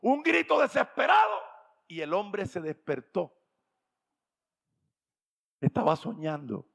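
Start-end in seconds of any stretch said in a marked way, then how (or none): 0.0-1.4 loud, high shouted telling; shouted narration. A narrator's reading. Preacher shouting the climax of the story to the congregation. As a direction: call it out to the whole church.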